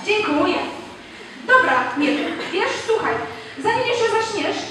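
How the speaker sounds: ordinary delivery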